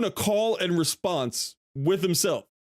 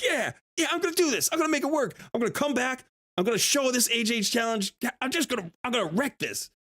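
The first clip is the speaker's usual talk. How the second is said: high, fast pep talk to yourself; pumped self-talk; 6 semitones higher than usual. Retold or imitated. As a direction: psych yourself up before the big try. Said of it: "I'm like".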